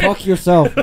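loud high laughing jab; laughing retort. Real, not acted. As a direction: fire back through laughter.

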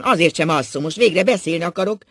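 loud, defiant insistence. A narrator's reading. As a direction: dig in loudly and stubbornly. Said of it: "hepciásan".

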